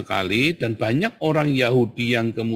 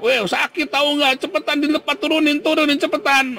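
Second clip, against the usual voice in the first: high-pitched, louder cries of pain and urgency; hammed-up, high pleading shout; mock yelling; 4 dB louder than usual. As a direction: yell like a victim demanding to be let down, played as parody. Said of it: "terus teriak-teriak".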